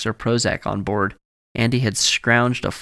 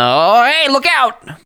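panicked shriek, much higher and louder; scream; 8 dB louder, slower than usual. Acted. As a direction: shriek the warning at the road ahead. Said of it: "Bert screamed".

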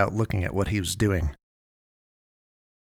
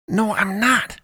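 high-pitched protest; insistent; 12 semitones higher, slower than usual. A narrator's reading. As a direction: insist on it, voice jumping up. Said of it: "Tyler insisted".

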